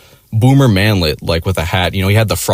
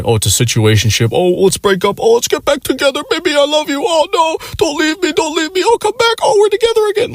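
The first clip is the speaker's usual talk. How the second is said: squeaky mocking voice climbing ever higher; mock couple drama. Mocking a couple's breakups and reunions in a squeaky high voice. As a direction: sends up a clingy on-off couple in a silly high voice.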